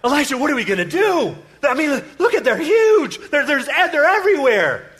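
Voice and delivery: high-pitched hammed-up alarm; panicked cry. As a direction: cry out as the servant facing an army. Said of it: "and he cries out".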